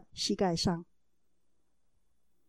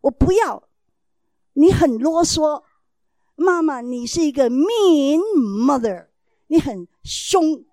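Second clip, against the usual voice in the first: higher, louder, slow whiny talking back; imitating defiant child; 5 semitones higher, 8 dB louder than usual. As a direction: mimic a kid sassing his mother. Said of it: "还跟你顶嘴".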